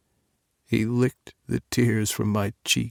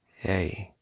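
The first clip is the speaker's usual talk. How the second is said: soft, gentle hey; a lover soothing him softly as he cries; gently; 3 semitones lower, 8 dB quieter than usual. Acted. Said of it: "Declan said gently".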